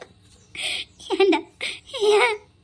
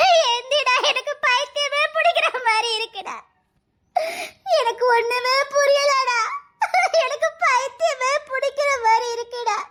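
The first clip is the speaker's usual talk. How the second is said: tearful lament. Acted. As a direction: wail and beg him to wake. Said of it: "இப்ப பைத்தியக்காரி மாதிரி புலம்பியதுக்கு". (அழுகையோடு மெதுவாக புலம்பல்)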